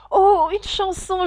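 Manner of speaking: high, loud, eager plea; Alice's eager high cry. Acted. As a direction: beg for a song excitedly. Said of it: "avec tant d'empressement".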